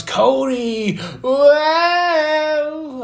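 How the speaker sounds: rising into a long, high whoop; loud, stretched-out excitement; excited hype. Real, not acted. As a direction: build up like an announcer, then holler. Hyping a surprise run-in.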